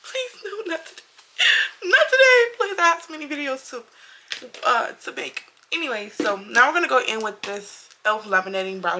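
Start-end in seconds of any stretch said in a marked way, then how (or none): 0.0-5.4 mock-panicked high pleading, near tears; begging the camera not to be broken. Vlogger retelling her broken camera panic, high mock-desperate plea. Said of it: "And I was like".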